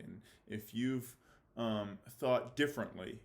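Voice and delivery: ordinary talk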